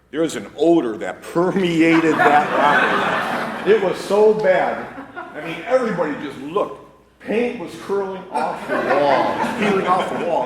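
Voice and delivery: cracking up while telling it; laughing as he describes the stench. Real, not acted. Laughing through gross story.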